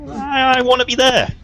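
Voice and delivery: high, drawn-up eager plea; eager insistence. Acted. Wanting in on the powerful thing, eager insistence. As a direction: push it up high and emphatic. Character insists on joining the scheme.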